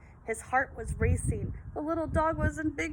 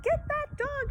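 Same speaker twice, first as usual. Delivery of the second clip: high shouted command; shouted order; 14 semitones higher than usual. A narrator's reading. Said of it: "shouted Papa".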